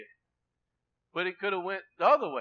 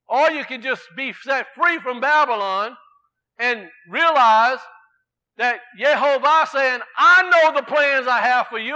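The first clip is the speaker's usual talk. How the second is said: raised, ringing sermon voice; preaching; 3 semitones higher, 5 dB louder than usual. Acted. Preacher urging the church to bring peace, preaching. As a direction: preach it to the congregation.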